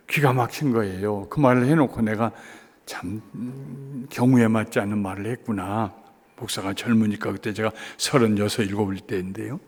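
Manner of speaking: ordinary delivery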